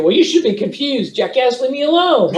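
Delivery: snarky customer voice; rude customer. Retold or imitated. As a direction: talk back like a smart-aleck customer. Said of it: "somebody could be a smart ass".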